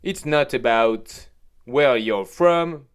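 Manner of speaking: a French speaker's mock English accent, drawn out slowly; a lightly put-on English accent, stretched slowly; self-mocking English accent. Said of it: "avec mon superbe accent anglais".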